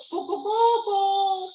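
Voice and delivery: high crowing rooster call; high, bright cock-a-doodle imitation. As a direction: crow it like the farm rooster.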